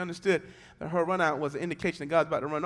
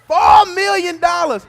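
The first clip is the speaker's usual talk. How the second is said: much higher, much louder, slow triumphant cry; excited shout; 13 semitones higher, 20 dB louder, slower than usual. Acted. Preacher revealing the gift to the church, excited shout.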